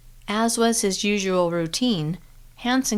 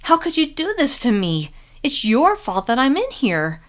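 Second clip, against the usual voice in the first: shaking voice; 7 semitones higher, 4 dB louder than usual. Acted. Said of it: "her voice shook".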